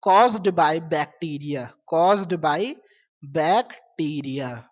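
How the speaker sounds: very slow, word by word; dictating to students, very slowly; slow dictation. Shown as a demonstration. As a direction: spell it out slowly for notes. Teacher dictating a definition.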